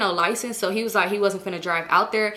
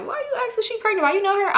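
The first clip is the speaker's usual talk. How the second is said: imitating suspicious boyfriend; 12 semitones higher, faster than usual. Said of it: "He like".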